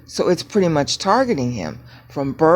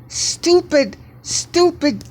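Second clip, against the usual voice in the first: much higher, frustrated "stupid, stupid"; exasperated outburst; 12 semitones higher, much slower than usual. Real, not acted. Teacher fed up with his PDF reader, pitched-up frustrated outburst. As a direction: snap at the software in real frustration.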